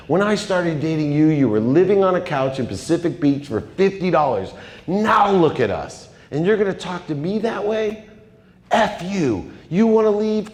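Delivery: high-pitched, escalating furious tirade; angry outburst. Retold or imitated. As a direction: build from indignation into a high, strained rant. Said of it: "the same response I had".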